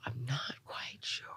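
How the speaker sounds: hushed, doubtful "not quite sure"; quiet puzzled aside; unsure whisper. Retold or imitated. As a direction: whisper it as if you're lost. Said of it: "sometimes it's like".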